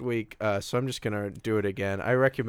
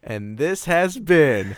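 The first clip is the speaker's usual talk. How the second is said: slow, drawn-out announcer sign-off; show sign-off announcer; 5 semitones higher, 7 dB louder, much slower than usual. Pretend. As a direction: stretch it like a hammy show outro. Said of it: "We seriously gotta stop doing that".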